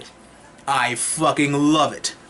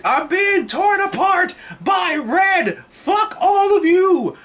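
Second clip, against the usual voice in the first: mock anguished shout; slower than usual. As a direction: wail it like a defeated cartoon villain. Thanos overwhelmed by Wanda.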